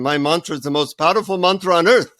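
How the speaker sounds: put-on guru boasting in an Indian accent; Indian-accented storyteller's impression of a guru. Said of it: "in his Indian accent".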